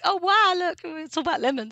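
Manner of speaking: mock thrilled discovery; excited gasp. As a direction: gush over the lemons. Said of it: "and I was like".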